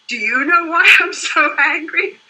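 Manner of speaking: laughing client question. Retold or imitated.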